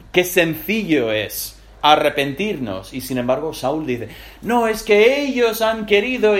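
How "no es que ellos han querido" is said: high, loud protesting excuse; whiny excuse. Acted. Saúl protests to Samuel, high and whiny. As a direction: pass the blame, pitched up.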